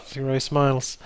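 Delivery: ordinary delivery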